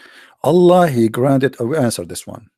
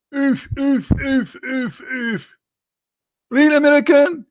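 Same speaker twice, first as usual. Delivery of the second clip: far higher, sputtering; squeaky mock stammer; 12 semitones higher, slower than usual. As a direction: splutter in disbelief.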